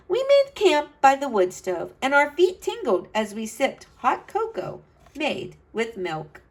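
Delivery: put-on bright, lively read-aloud to kids; storybook narration. A narrator's reading. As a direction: tell it like a storyteller for children. Reads picture book aloud.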